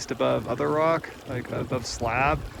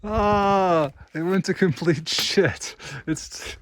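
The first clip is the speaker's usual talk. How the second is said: laughing in dismay; 7 semitones higher, much slower than usual. Real, not acted. Boulder field turns bad.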